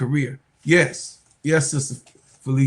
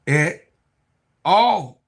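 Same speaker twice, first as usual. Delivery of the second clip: usual speaking voice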